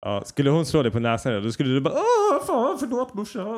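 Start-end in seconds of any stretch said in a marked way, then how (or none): 1.9-3.6 high wailing apology, tailing off; mock crying. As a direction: whimper like a scolded child to mum. Acts crying grown son hit by mum. (spelat gråtande, ljust gnäll)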